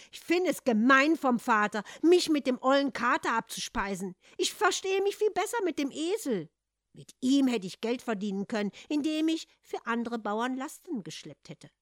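loud, high, bitter grumbling; a sulking son railing at his lot; young man complaining loudly; angry complaint. Acted. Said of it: "schimpfte Hans".

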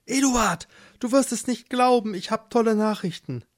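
high, breathless-with-news excitement; excited wife. Acted. Marleen runs to husband with news, excited high voice. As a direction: burst in with great news. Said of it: "Du bist ja ganz aus der Puste".

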